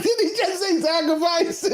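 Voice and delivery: high-pitched, incredulous retold reaction; stunned disbelief. Host retelling his reaction to the interview, stunned disbelief. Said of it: "'Cause I was so stunned".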